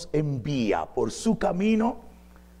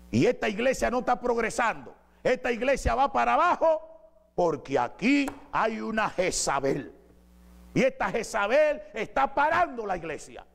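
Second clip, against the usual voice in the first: higher, preachy false-prophet voice; imitating a self-important prophetess; mock prophetess; 10 semitones higher than usual. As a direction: ham up a pompous prophetess pronouncing judgment.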